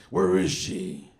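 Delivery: slow, drawn-out superhero growl; heavy, stretched-out demand; Batman. Retold or imitated. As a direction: imitate a movie vigilante's gruff interrogation. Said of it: "remember the second Batman movie".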